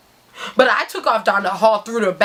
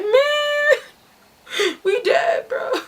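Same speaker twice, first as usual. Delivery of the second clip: very high, whiny doom cry; panicked wail; much slower than usual. Retold or imitated. Storyteller tells the driver they are doomed, wailing. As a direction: wail it as if all is lost. Dread after Z's livid call.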